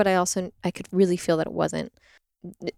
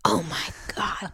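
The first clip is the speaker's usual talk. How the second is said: whispered, softer stunned awe; awed whisper; 6 dB quieter than usual. Retold or imitated. Woman meets a midwife at a party, whispered awe. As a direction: breathe it out in hushed awe.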